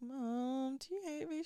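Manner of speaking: little mock-pitiful question; needy child plea. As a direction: ask Mum in a small voice. Acts out asking her mum.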